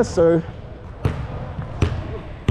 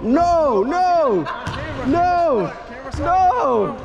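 excited protest; 9 semitones higher, much slower than usual. Real, not acted. Missed shot, rising protest.

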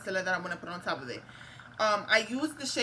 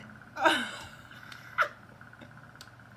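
breathy laugh; 5 semitones higher than usual. Real, not acted. Laughing at own accent.

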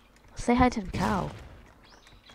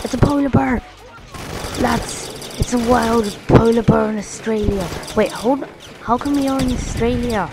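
higher and louder, thrilled at the polar bear; excited surprise; 5 semitones higher, 4 dB louder than usual. Real, not acted. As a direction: blurt it out amazed. Spots polar bear in game.

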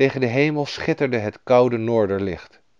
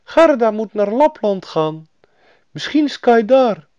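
high, loud bird voice; fairy-tale pigeon, pitched way up; talking doves; 12 semitones higher, 6 dB louder than usual. A narrator's reading. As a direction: chirp it out as a storybook dove. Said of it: "De duiven zeiden".

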